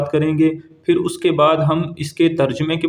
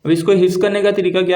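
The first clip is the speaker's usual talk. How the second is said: ordinary talk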